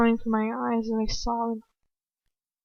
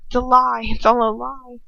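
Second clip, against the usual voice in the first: usual speaking voice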